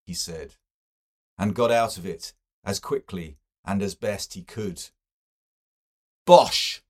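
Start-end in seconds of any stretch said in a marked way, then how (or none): 6.2-6.8 loud, high scoffing bark; rude dismissal. A narrator's reading. Stephen rudely dismisses Eglinton's claim with a loud scoff. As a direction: spit it out to shut him down. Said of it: "Said Stephen rudely".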